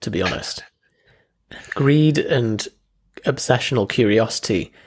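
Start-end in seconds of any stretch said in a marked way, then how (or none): 0.0-0.6 whispered aside. Real, not acted. Quiet aside after confession.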